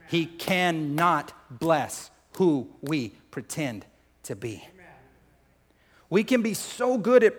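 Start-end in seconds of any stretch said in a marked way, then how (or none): 0.1-4.7 very slow, each word set apart; word-by-word emphasis. Real, not acted. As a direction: drive it home, pausing between "cannot", "bless", "who", "we". Repeats key point word by word.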